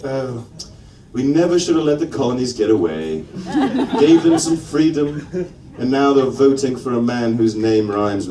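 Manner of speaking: put-on British accent. Comedian as Brits regretting losing the colonies, British accent. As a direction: lament it drily in a British accent. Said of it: "They'll be sitting back going".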